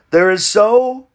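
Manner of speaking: normal talking voice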